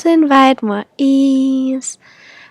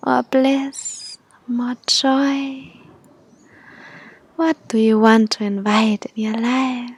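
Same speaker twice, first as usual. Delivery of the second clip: airy breathy mystical healer. Acted. Leads a guided meditation.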